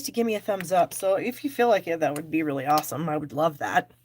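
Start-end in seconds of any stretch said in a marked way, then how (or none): none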